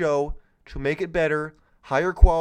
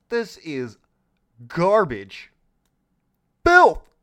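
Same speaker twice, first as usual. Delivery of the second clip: disgusted shout; 7 dB louder, slower than usual. Real, not acted. Disgusted outburst at company claims.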